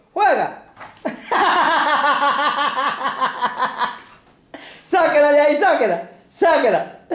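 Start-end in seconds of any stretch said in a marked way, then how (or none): 1.0-3.9 soft laugh. Real, not acted. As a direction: laugh quietly at the dog. Laughing at dog.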